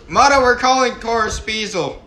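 loud call to someone in the next room. Real, not acted. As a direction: call out across the house. Calling out to friend.

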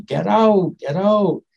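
mock scream, pitched high but held back; retold scream. Friend tied down screaming at the storyteller in a nightmare, mock scream. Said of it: "he was screaming at me".

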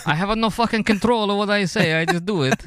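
put-on high, Borat-like foreign accent; mock Italian accent. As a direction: badly fake Italian, ending up like Borat. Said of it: "you sound like Borat".

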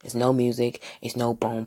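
ordinary talk

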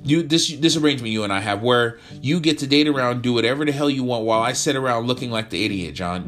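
high, loud angry confrontation; heated quarrel. Acted. Girlfriend confronting John about the arrangement, loud angry fight. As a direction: fire every line back at him.

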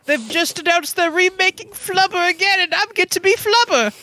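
very high squeaky parody voice; absurdly high celebrity send-up; Tom Holland. Acted. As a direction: announce yourself in a silly, very high voice. Continuing the Tom Holland parody bit.

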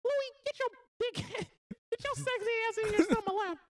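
high squeaky mouse boss; Mickey as gangster boss. Acted. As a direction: falsetto cartoon mouse scolding. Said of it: "he do like a Frank".

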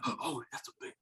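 hushed, whispered fluster; nervous. Retold or imitated. Nervous dad spotting a big buck, imitated whisper. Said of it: "he got nervous".

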